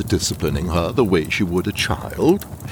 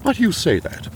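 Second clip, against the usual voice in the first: defensive; 10 semitones higher, faster than usual. Acted. Defensive at detective's insinuation.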